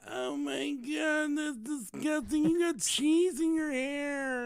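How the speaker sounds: put-on high, slow disgusted child; disgusted girl voice. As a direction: whine like a grossed-out girl. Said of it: "is like".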